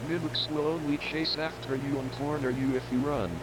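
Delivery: ordinary delivery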